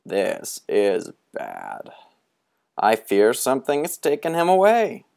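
high, looming, theatrical beckoning; sinister old storyteller. Acted. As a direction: lure the boys toward the dark. Moundshroud grim about Pipkin.

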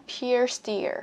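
"Pierced ear" run together as one word; linked sounds. Shown as a demonstration. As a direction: carry the end of "Pierced" straight into "ear". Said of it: "hoàn toàn có thể nối âm ở đây". ("Pierced ear" nối âm liền nhau)

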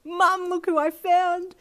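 high, loud and drawn out slowly; bursting with the news; thrilled call. Acted. Girl calling her mum downstairs, thrilled to show Ichiro.

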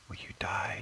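soft, voiceless whisper. Pretend. Player urges a game mob to die, whispering. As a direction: whisper it to the creature you're attacking.

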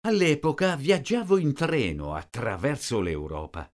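normal talking voice

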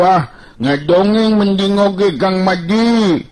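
put-on high, slow, drawn-out voice of a tired young fellow; Banteng Bulung voice. Banteng Bulung grumbles to Rawing about hunger, high and drawn-out. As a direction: stretch the words out high and slow, complaining. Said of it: "Si Banteng Bulung ngareret ka si Rawing bari nyarita".

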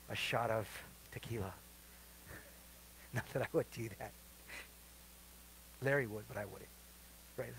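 low, soft throwaway joke; hushed, low-pitched comic confession; joking aside. Real, not acted. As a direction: drop the voice for a sly aside. Joking aside after calming story.

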